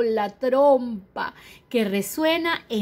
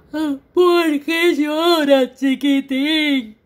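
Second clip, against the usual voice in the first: slow, louder, drawn-out tiger; 4 semitones higher, 6 dB louder, much slower than usual. Acted. Tiger slowly asks the crying baby, drawn out. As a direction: stretch each word like a big cat.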